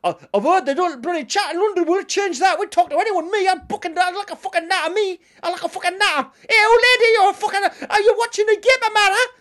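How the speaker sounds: put-on loud, high rowdy Geordie accent; Geordie fan. Geordie fan chatting up Londoners in a loud Geordie accent. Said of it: "They're like".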